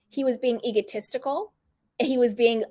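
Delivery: usual speaking voice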